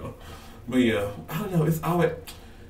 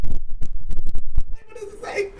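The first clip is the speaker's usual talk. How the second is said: a high, slow, breathless shriek; real panicked squeal at the result; anxious shriek; 11 dB louder, much slower than usual. Real, not acted. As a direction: scream it out in real suspense. Looking at her test result.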